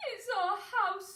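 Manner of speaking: slow squeaky mother mouse; a hint of a squeaky mouse. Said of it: "squeaked the mother mouse".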